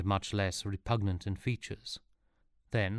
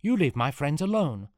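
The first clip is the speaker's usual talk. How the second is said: higher, louder shout; 7 semitones higher, 6 dB louder than usual. A narrator's reading. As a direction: shout defiantly at the villain. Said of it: "shouted Sean".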